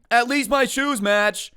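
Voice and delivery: high, loud, slow yell; sudden shouted outburst. Acted. Student breaks the silent classroom, shouting out of nowhere. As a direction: burst out absurdly into dead silence. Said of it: "no one's really talking".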